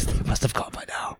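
mock whisper. Cohosts whisper after signing off, playful whisper. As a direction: whisper it like a secret.

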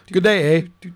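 light hoser Canadian greeting; mock Canadian accent. Host greets players from Canada, mock Canadian accent. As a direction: put on a touch of Canadian, eh.

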